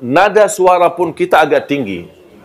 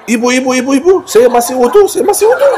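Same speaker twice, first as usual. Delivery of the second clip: high, laughing, flustered plea to keep away; a mock panicked laughing protest; laughing mock panic, much higher; 10 semitones higher, 3 dB louder, faster than usual. Pushing away clinging women, laughing.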